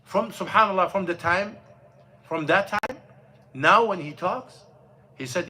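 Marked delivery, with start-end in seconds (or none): none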